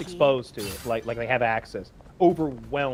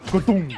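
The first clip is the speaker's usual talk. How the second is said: loud, higher-pitched "ka-boom" sound effect; imitated boom; 6 semitones higher, 4 dB louder than usual. Describing the ground radar machine to co-hosts, imitated explosion. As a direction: imitate the shotgun shell going off with a boom. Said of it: "and it goes".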